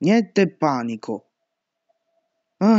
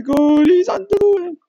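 high, loud frightened cry; terrified high shout; panicked shout; 6 dB louder than usual. Real, not acted. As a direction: yell in fright. Panicked in horror game.

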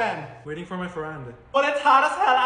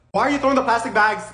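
ordinary talk

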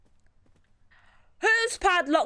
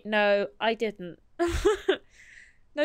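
usual speaking voice

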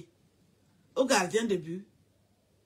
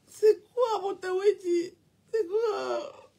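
much higher, sobbing whines; mock crying; 7 semitones higher, much slower than usual. Mocking the woman asking what happened, in high mock sobs. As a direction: whine and sob like a parody of her.

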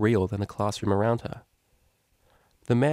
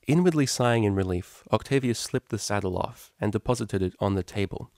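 plain reading voice

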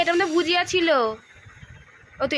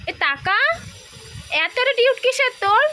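raised-voice playful telling-off; mock scolding; 5 semitones higher than usual. Real, not acted. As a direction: tell the bird off with playful exasperation. Scolding the pet's attitude.

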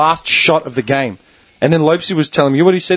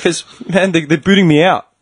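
usual speaking voice

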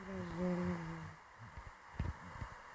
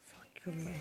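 whispering; 3 semitones lower, 15 dB quieter than usual. Real, not acted. Muttered aside in game.

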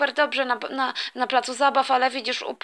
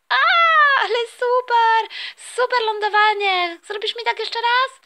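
put-on much higher, louder child voice; little sister begging her brother to do it again, high child voice; thrilled little girl; 10 semitones higher, 4 dB louder than usual. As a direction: beg for one more go. Little sister after landing.

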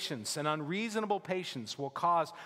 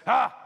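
a loud, higher exasperated "Ah!" like a fed-up father; angry dad huff; 9 semitones higher, 12 dB louder than usual. Retold or imitated. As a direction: blast out a dad's angry huff at a messy room. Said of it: "Dad come in and see the state of your bedroom and say, he goes".

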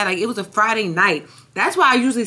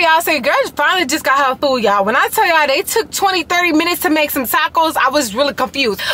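usual speaking voice

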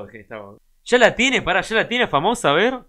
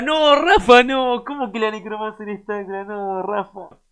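dismayed protest; 6 semitones higher, slower than usual. Real, not acted. Streamer protesting his friend Rafa's choice, dismayed. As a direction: cry out in dismay, then trail into a plaintive whine.

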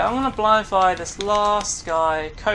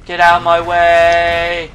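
frustrated shout; 8 dB louder, slower than usual. Real, not acted. Blocked by another player.

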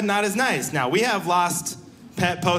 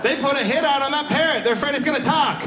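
high-pitched punchline; 8 semitones higher than usual. Real, not acted. Punchline about parrot hit.

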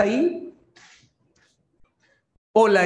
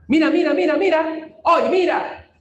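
quick, higher run of excited miras; excited discovery; 8 semitones higher than usual. Real, not acted. Teacher thrilled to show the solution, excited high calls. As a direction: call them to look, thrilled at the find.

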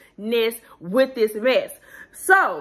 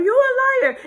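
very high mock accusing cry; imitated hollering; 12 semitones higher, 3 dB louder, much faster than usual. Mimics Robin joining the hollering.

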